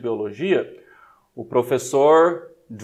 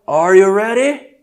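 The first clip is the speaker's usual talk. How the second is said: hype call; 6 semitones higher, 8 dB louder, slower than usual. Acted. Hyping students for the next round.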